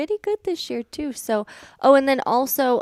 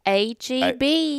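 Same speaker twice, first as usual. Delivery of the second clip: country accent; 4 semitones higher, much slower than usual. Shown as a demonstration. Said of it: "Try that, Josie".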